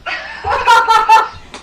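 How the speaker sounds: a high, loud flustered laugh. Real, not acted. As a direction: laugh while juggling the phone. Host laughs while fumbling camera.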